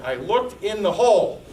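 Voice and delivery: retelling a friend's tearful late-night phone call; overwhelmed emotion. Retold or imitated. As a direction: blurt it out like a friend in tears of joy. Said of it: "Frank was so emotional and so upset".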